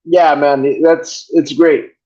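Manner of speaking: usual speaking voice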